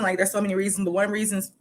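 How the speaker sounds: normal talking voice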